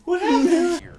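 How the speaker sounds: squeaky high yelping Wookiee; Chewbacca voice. Retold or imitated. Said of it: "he's just like".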